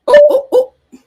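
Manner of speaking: high, loud startled oh-oh-oh; shocked squeal of surprise; real shock bursting out high; surprised gasping oh. Real, not acted. Reacting to shocking update.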